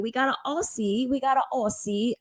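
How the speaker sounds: put-on a playful stab at an Australian accent; lightly put-on Australian accent; mock Australian accent. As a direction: try an Aussie accent, high and light. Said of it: "my accent probably fucking sucks".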